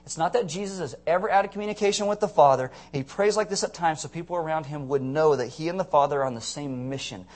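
ordinary talk, nothing marked

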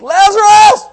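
huge high shout of the name; shouted call. Shown as a demonstration. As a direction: bellow Lazarus across the graveyard. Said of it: "It's like".

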